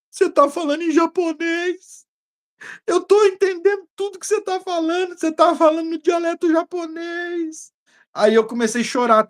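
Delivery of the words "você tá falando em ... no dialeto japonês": amazed cousin. Retold or imitated. Cousin telling the preacher he speaks Japanese, astonished and high. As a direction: marvel at what you hear. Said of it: "Aí ele falou pra mim".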